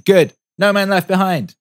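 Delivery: put-on high, loud little-boy shout; the son shouting Jocko catchphrases, loud and high; kid shouting. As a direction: yell it like a fired-up six-year-old. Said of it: "running around shouting".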